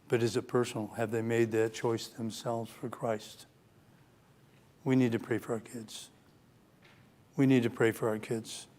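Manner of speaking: low, soft and heartfelt; hushed, earnest plea. Real, not acted. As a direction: drop to a quiet, sober appeal. Sober plea for drifting children.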